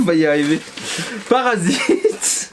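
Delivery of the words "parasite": laughing. Real, not acted. Laughing while listing.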